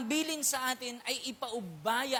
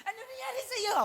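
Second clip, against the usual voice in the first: sister asking; 12 semitones higher than usual. Retold or imitated. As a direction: voice the older sister gently asking. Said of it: "Sabi ko ganyan". (mataas at mahinang tanong ng nag-aalalang ate)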